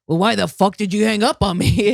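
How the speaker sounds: imitating an indignant mother on the phone; retold irate mom. Mom calling out the teacher who hung up on her, mock indignant. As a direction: challenge him as a cross parent, mildly hammed-up.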